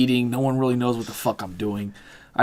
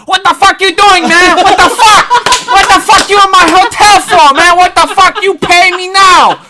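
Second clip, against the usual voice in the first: put-on high, loud accented shouting tirade; 16 dB louder than usual. Hotel manager surprising troops in his lobby, shouted in caricatured accent.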